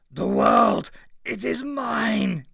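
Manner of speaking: high, loud, shrieking creature voice; a monstrous creature shrieking its claim; monster shriek. A narrator's reading. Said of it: "it shrieked".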